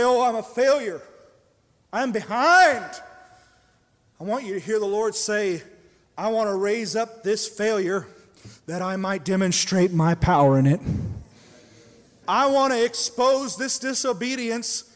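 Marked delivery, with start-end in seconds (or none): 0.0-3.0 mock despairing believer. Voicing a discouraged believer. 6.2-14.8 loud, ringing voice of the Lord; booming preached promise; full-force preaching. Acted.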